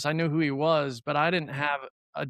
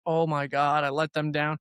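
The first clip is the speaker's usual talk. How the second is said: ordinary delivery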